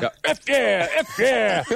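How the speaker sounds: loud, high repeated taunt; hammed-up announcer yell, twice; game taunt. Repeating the taunt for a doubting friend, with a loud, high hammed-up yell. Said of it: "That's what it says".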